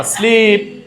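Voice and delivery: স্লিপ with a drawn-out EE; long vowel sleep. Shown as a demonstration. Teacher models the double-E rule, lengthened vowel in স্লিপ. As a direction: stretch the middle of স্লিপ: sleeep. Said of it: "এক আলিফ লম্বা".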